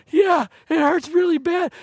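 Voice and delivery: put-on high childish whine; whiny little girl. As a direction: play a small girl milking the hurt. Daughter answers father.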